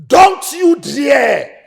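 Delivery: very high, loud, slow threat; furious warning. Acted. Brother warns his sister not to insult him, furious shout. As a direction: spit each word as a threat.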